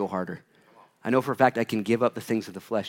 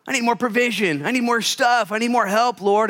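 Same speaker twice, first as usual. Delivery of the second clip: high, loud mock whining for more; complaining whine; 11 semitones higher, 5 dB louder than usual. Preacher mocks a believer begging God for more, whiny. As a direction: whine to God like a needy child.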